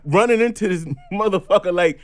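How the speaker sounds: loud, high, fed-up move on; exasperated yelling. Real, not acted. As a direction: wave off the pest, voice soaring. Exasperated at a harasser.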